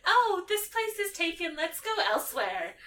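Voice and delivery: put-on bubbly girlfriend voice; imitating Lavender. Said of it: "she's like".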